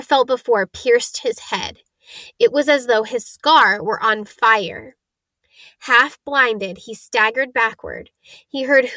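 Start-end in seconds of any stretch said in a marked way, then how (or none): none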